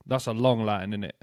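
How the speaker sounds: usual speaking voice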